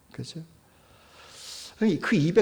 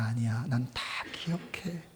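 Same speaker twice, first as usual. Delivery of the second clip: ordinary talk, nothing marked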